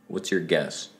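usual speaking voice

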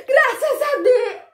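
high, loud thankful exclamation; relieved cry. Acted. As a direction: burst out in relief. Relief after silencing poop. (alívio exagerado, voz aguda)

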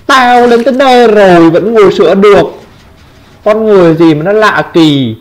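high-pitched exclamation of disbelief; indignant disbelief. Real, not acted. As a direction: exclaim high, outraged at the opponent's nerve. Bức xúc vì đối thủ vẫn sửa máy. (kêu lên cao giọng, không tin nổi)

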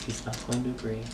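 very soft, secretive murmur to an ally; conspiratorial whisper. Acted. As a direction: drop to a hushed whisper, slowing at the end. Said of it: "I whisper to you".

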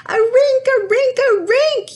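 very high, loud happy shout; high joyful yell repeated. A narrator's reading. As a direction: shout it out like a thrilled kid. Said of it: "yelled Sierra".

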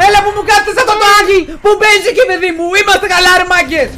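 high, loud and fast; excited yelling. Real, not acted. Streamer yelling at his teammates in the round. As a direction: yell it at the screen.

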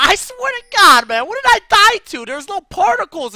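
high, loud disbelief; outraged shout. Real, not acted. Gamer after an unfair death, shouting high and loud. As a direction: yell it at the game, outraged. Raging after dying in game.

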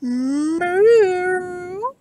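a high, loud, drawn-out cow moo, crooned; old Tom crooning a loud moo. A narrator's reading. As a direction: coax the cow with a sweet moo. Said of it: "crooned old Tom".

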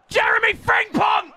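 high, ringing goal yell; excited shout. Real, not acted. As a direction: shout the scorer's name in celebration. Shouts scorer's name.

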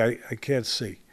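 soft, low and defeated, almost swallowed. Retold or imitated. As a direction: mutter it softly like a beaten fighter. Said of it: "might say in a very low tone".

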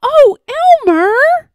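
very high, loud pleading call. A narrator's reading. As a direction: call desperately after someone leaving. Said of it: "called Wilbur".

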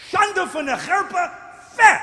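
loud Yiddish shaming rebuke; Abraham scolding God. Acted. Abraham shaming God in Yiddish, loud rebuke. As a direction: shame God with full force. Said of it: "That's what Abraham says to God".

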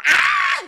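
piercing, loud re-enacted shriek; high scream. Re-enacting her reaction to being grabbed, high scream. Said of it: "Y yo le gritaba".

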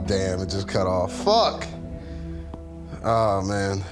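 usual speaking voice

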